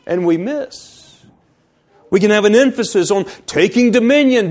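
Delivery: emphatic, urgent sermon delivery; forceful preaching. Real, not acted. Preacher warns the congregation about wrong emphasis in reading Scripture, forceful preaching. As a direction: drive the point home in full pulpit voice.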